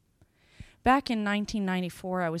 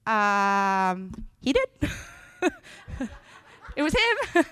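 laughing disbelief; 4 dB louder, much slower than usual. Acted. Crowd voiced in retold story.

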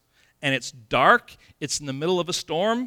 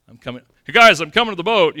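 1 mock stomping Jesus; 2 semitones higher, 10 dB louder, faster than usual.

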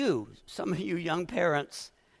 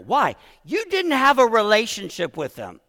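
pushing through welling feeling, louder and higher; getting emotional; 6 semitones higher, 8 dB louder than usual. Real, not acted. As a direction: voice rising and louder with real emotion. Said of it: "Sorry, I'm getting a little emotional here".

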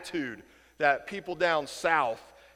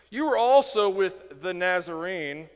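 slow, louder pointed accusation; accusing tone; 2 semitones higher, 7 dB louder, slower than usual. Retold or imitated. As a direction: lean on it, then drop the name. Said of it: "said it with a tone".

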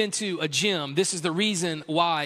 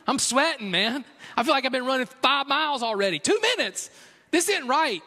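higher, winded disbelief at the treadmill; mock outrage, pitched up and incredulous; exasperated runner; 6 semitones higher than usual. Runner on a treadmill griping at the counter, exasperated and higher. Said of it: "I'm like".

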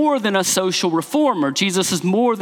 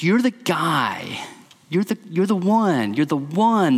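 ordinary talk

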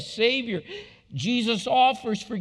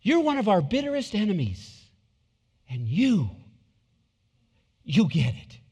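a lightly voiced Jesus addressing her with emphatic pauses; Jesus telling the woman she understands. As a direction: give her the verdict with weight. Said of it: "See what he's saying?".

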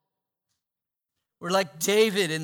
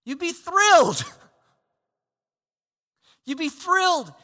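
much higher and louder, bursting with joy; light up with delight; preacher excited at the thought of the promise; thrilled; 11 semitones higher, 8 dB louder than usual. Real, not acted. Excited exclamation about believing.

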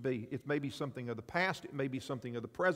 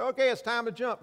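instructor calling; 8 semitones higher, 6 dB louder than usual. Acted. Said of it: "They say".

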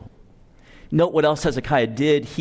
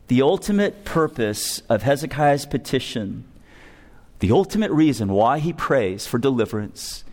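ordinary talk, nothing marked

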